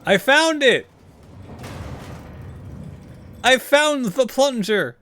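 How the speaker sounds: excited shout. Real, not acted. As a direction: shout the discovery with glee. Excited discovery in game.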